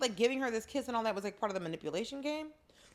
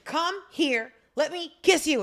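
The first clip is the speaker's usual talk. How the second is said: louder, higher mock insistence; hammed-up pushy come-on; playful demand; 5 semitones higher, 8 dB louder than usual. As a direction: demand the kiss, loud and eager. Acts out a pushy would-be kisser.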